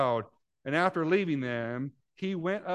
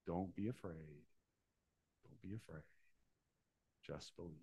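reassuring; 9 semitones lower, 18 dB quieter than usual. Acted. Quoting Jesus's comforting words.

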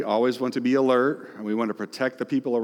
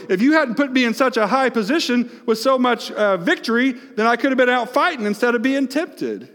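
blaming God; 11 semitones higher, 4 dB louder than usual. Retold or imitated. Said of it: "Maybe even blame God a little bit".

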